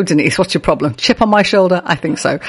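normal talking voice

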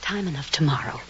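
hushed whisper out of earshot; breathy secret whisper to a friend. Acted. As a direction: whisper it so Helen won't hear. Hiding the news from Helen.

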